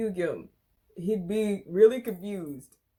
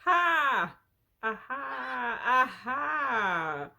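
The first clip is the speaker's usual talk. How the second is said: triumphant mock laugh; 6 semitones higher, much slower than usual. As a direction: crow it in long, showy bursts. Mock triumph over own height.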